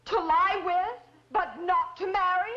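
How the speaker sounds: loud, high-pitched indignant challenge; indignant outburst. Acted. Widow confronting her lover over marriage, angrily indignant. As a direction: throw it at him, hurt and angry.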